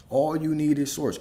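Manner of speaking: ordinary talk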